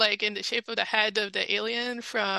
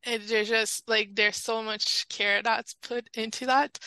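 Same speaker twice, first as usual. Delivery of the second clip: usual speaking voice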